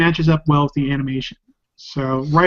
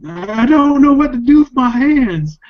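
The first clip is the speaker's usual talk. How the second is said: Ricky Bobby quote; 9 semitones higher, 6 dB louder than usual. Retold or imitated. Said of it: "Ricky Bobby".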